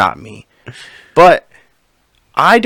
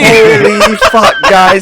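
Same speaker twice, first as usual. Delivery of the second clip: exasperated; 7 semitones higher, 7 dB louder, much slower than usual. Real, not acted. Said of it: "What a morning".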